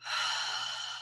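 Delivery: long sigh out through the mouth; a slow, audible breathing-break sigh; demo exhale. Shown as a demonstration. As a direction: show the room how to blow the breath out. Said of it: "take a deep breath in through your nose".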